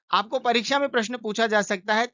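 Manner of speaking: normal talking voice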